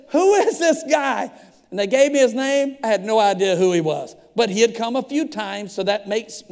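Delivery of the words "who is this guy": loud, high, slow exasperated question. Retold or imitated. Pastor asks police about the stranger, exasperated. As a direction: stretch it out in raised-voice bafflement. Pastor retells baffled demand.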